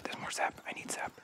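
soft excited whisper. Real, not acted. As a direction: whisper it, afraid to be heard. Sneaking through scary forest.

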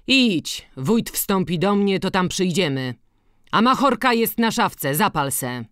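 high, loud send-off; calling out. A narrator's reading. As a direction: call after him as he leaves. Kowal woła za odchodzącym Antkiem. (głośno, wołając za nim)

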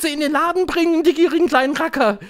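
high, loud, fast excited cry; shrill-pitched collective yell; shouting crowd. A narrator's reading. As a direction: shout it out quickly like a delighted audience. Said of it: "schrie das Publikum".

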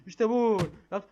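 victory whoop; excited cheer. Real, not acted. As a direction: celebrate the win. Celebrating success in the game.

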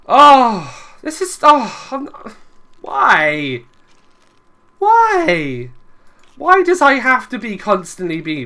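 high, loud wailing complaint; loud, frustrated cries; exasperated despair. Real, not acted. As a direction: wail at the injustice of it. Despairs over destroyed camp.

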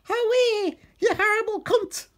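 loud falsetto outrage; angry woman character. A narrator's reading. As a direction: scold in a very high mock-female voice. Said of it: "she said, hitting Steve repeatedly".

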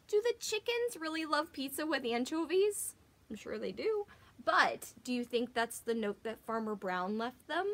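usual speaking voice

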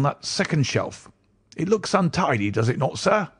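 put-on high old bookseller's voice, eager to sell; croaking old book collector. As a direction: pitch it up as an odd old man pushing his wares.

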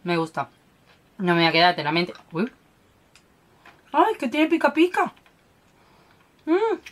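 sucking on a sweet; talking around candy; mouth full of candy. Real, not acted. Seen 2: Lips pursed and cheek bulging throughout; hand often at mouth.

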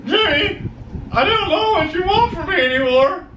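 high whiny character voice. Retold or imitated. Acting out a Trailer Park Boys scene.

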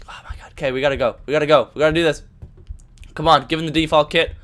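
usual speaking voice

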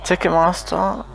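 ordinary talk, nothing marked

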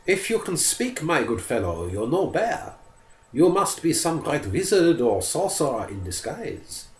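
a hint of the forester sizing up the bear; brave forester thinking. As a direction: forester reasoning to himself. Forester's inner thoughts.

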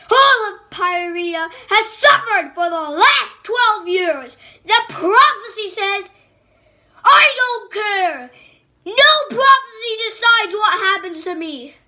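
high, loud furious queen; furious outburst. Acted. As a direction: rage at him, cut him off.

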